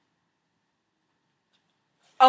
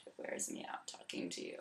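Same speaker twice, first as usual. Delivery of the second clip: a tired breathy whisper; weary whisper; 22 dB quieter, faster than usual. Acted. Sasha worn out by his self-pity, whispered. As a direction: sigh it out under your breath.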